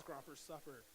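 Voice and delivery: ordinary talk, nothing marked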